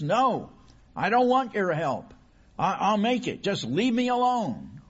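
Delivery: loud, high angry yelling; furious shout. Acted. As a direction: shout the helper away, stubborn. Injured man refusing the doctor.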